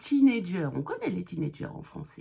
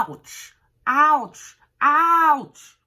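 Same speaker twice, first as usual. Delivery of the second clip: loud, high cries of ouch; pained ouch; 7 semitones higher, 12 dB louder, much slower than usual. Shown as a demonstration. Teacher yelps ouch to show pain. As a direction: yelp it like it really hurts. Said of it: "Ça veut dire aïe".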